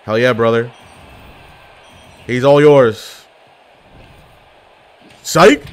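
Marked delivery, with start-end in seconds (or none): 5.2-5.6 high, loud teasing gotcha; mocking the opponent who trusted him; taunting. Real, not acted. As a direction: fake them out with a jeer. Taunting a fleeing enemy.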